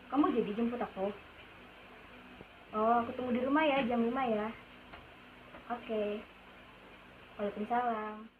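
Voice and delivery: put-on soft, low sweet talk to a boyfriend; hushed phone call. Daughter secretly murmuring on the phone to her boyfriend. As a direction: keep it hushed so mom won't hear.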